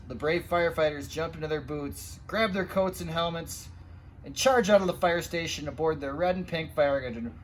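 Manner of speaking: ordinary delivery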